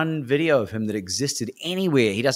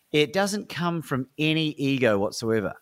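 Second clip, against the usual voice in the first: ordinary talk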